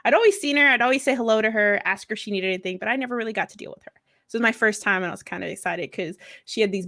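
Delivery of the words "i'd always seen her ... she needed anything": ordinary delivery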